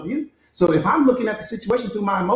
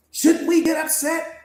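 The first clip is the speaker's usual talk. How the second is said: higher, pleading voice of a doubting student; 8 semitones higher, slower than usual. Acted. Coach voicing a student asking whether to get angry, higher and pleading. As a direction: ask it like a student who wants permission to be angry.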